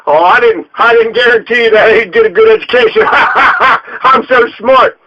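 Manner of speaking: mocking a boss who laughs at his own cleverness; imitating a smug gloating official; smug laughing boss. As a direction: chuckle through it like a self-satisfied official. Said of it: "He'd think he was so smart, too".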